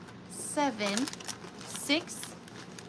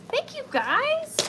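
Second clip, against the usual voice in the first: singsong; 13 semitones higher, 4 dB louder, slower than usual. Real, not acted. High, slow singsong thanks.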